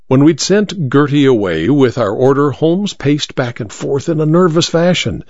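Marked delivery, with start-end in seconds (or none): none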